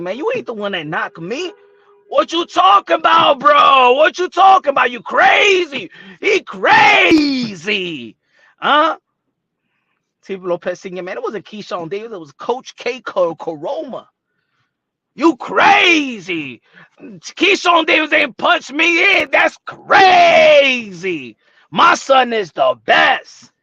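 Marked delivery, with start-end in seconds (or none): 0.0-9.0 imitating angry father. Teofimo Senior protesting about the knockdown, loud mock outrage. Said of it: "Teofimo Lopez Senior said". 15.1-23.5 loud, slow, drawn-out protest; mock angry dad. Father defending his son, loud and slow. Keeps imitating Teofimo Senior.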